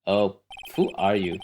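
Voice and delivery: ordinary talk, nothing marked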